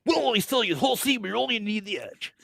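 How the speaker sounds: high, fast hyped ad voice; car ad announcer. Retold or imitated. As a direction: rattle it off like a cheesy TV spot. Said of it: "Yeah, yeah".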